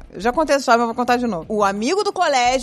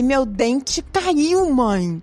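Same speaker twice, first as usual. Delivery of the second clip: put-on slow child line; much slower than usual.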